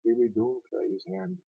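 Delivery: rolling, dramatic ballad reading; a Scots war poem read aloud with feeling; poem recitation. Acted.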